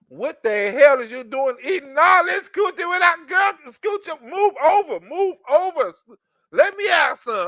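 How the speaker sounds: high, loud eager voice; hammed-up joining in; mock excited barge-in. Man jumping on the bed, high excited voice. Comic voicing himself barging in.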